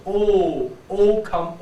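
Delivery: O sounded with a very round mouth. Shown as a demonstration. Teacher showing students a perfect O, rounded. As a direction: round the lips fully on O. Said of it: "it is the O".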